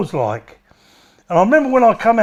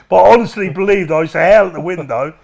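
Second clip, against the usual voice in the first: ordinary talk, nothing marked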